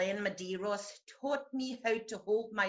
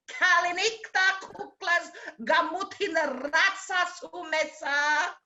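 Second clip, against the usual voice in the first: excited loud sign-off; 4 semitones higher, 6 dB louder than usual. Acted. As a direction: belt out a party goodbye.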